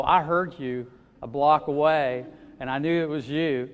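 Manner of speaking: ordinary talk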